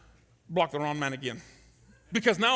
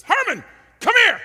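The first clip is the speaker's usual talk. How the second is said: loud high call; loud coach summons; 12 semitones higher, 12 dB louder than usual. Retold or imitated. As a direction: shout him over across the field. Coach calling him over.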